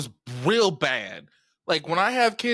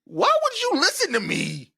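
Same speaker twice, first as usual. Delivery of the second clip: put-on high-pitched, incredulous kid arguing back; exasperated child's comeback, acted out; indignant protest; 6 semitones higher than usual. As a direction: protest to mom in a raised, higher pitch. Acting out childhood protest to mom.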